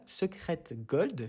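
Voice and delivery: Secret Gold said very slowly, word by word; slowly re-say the name after tripping; slow careful redo. Real, not acted. Fixing a stumbled card name for viewers, very slow. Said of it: "je ne sais plus parler".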